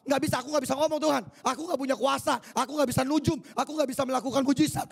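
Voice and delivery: high, fast, whining excuses; Moses making excuses. Acted. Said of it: "Musa bilang apa?".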